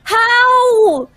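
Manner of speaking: high shocked cry; stunned. Real, not acted. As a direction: shout it in disbelief. Caster shocked again.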